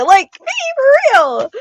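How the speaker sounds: very high, drawn-out whine; exasperated squeal. Real, not acted. Exasperated rant about crying brother.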